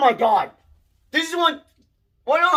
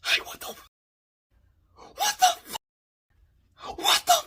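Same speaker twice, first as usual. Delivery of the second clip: stunned repeat; 4 dB quieter than usual. Real, not acted. Stunned by the killing.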